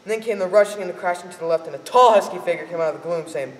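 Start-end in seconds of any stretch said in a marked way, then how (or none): none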